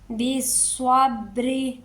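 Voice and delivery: slow, drawn-out tajweed chant; Quran recitation. Shown as a demonstration. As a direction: recite it like a qari, stretching each sound. Recitation demo. (धीमी तजवीद वाली तिलावत)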